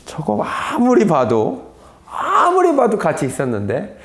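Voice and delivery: drawn-out, pondering suspicion; suspicious onlooker. Retold or imitated. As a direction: stretch it out as if studying someone closely. Suspicious onlooker eyeing Peter. (의심스러운 듯 길게 끄는 말투)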